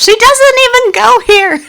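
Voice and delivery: high, loud movie-quote outburst; mock indignant call-out; Mean Girls quote. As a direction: throw out the film line, high and loud. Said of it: "It's like in Mean Girls".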